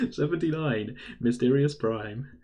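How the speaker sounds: slow, drawn-out bingo call; bingo caller. Shown as a demonstration. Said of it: "That's my bingo call now".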